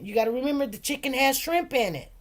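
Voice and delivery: ordinary delivery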